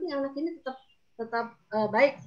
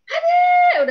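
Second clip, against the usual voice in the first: put-on high, loud yell at a child; alarmed shout; 13 dB louder than usual. Adult yelling at a child who has climbed up, alarmed shout. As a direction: shout out sharply to stop them. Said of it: "berteriak dari jauh".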